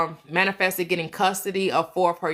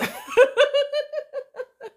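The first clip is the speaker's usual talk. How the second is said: high laugh. Real, not acted. Laughing about taking the DMs.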